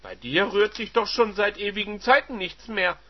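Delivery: high, loud mockery; mocking wife. Wife mocking her husband, high and loud. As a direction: jab at his manhood.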